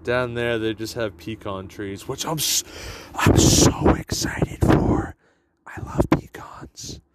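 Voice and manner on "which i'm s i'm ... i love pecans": loud whisper; whisper yelling. Real, not acted. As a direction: whisper it with force. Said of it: "Why are you whispering?".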